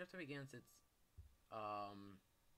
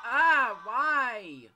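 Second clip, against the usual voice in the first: very loud high scream of fright; shrieking at a jump scare; scared scream; 11 semitones higher, 23 dB louder than usual. Real, not acted. Jump scare in horror game.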